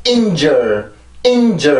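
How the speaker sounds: 인저 with the pitch dropping abruptly off the first syllable; dropping tune. Shown as a demonstration. Teacher imitating students' mistake, abrupt falling tune. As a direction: let 인저 plunge straight down, no lift. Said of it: "이렇게 뚝 떨어뜨리게 되는데".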